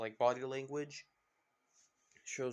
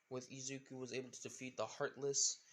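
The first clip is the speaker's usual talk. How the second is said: usual speaking voice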